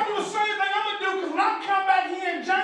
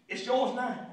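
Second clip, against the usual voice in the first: low, soft quoted line; quiet retold handover; 8 semitones lower, 7 dB quieter than usual. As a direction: drop it low and soft, imitating him.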